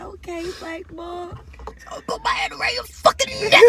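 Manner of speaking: high, then loud threat; mock threat. Quoting a protester threatening Cuomo.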